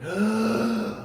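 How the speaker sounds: a second smaller gasp; soft gasp. Pretend. Second revival breath.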